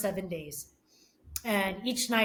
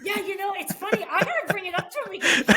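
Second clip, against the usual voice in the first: high, loud talk through laughter; laughing; 12 semitones higher, 8 dB louder, faster than usual. Real, not acted. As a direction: laugh while you say it. Laughing at funny memory.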